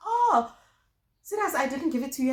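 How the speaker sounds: high, loud startled oh; mock surprised nurse. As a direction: act the nurse caught out. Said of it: "She's like".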